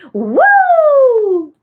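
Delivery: high, loud drawn-out whoop; excited woo. Real, not acted. Host cheers for [name] on the stream, excited whoop.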